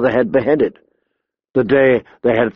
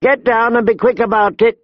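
high, impatient command; ordering woman brigand; 9 semitones higher than usual. A narrator's reading. Robber woman orders boy off his horse, impatient. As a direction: order him down in a raised pitch.

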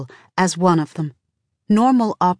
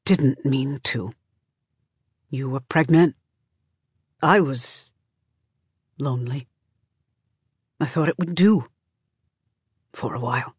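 weary effort to get words out; mustering the words; 3 semitones lower than usual. A narrator's reading. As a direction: labour out each phrase, letting it drop away. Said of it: "takes him a while to muster the words".

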